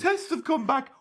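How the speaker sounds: high, loud zany whooping; Three Stooges voice. Acted. Doctor Swivels with a diagnosis.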